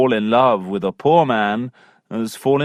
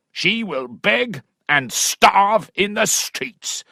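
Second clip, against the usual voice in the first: furious squire; 8 semitones higher than usual. Acted. Angry father shouting at Allworthy about his daughter. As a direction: roar it like a raging country squire.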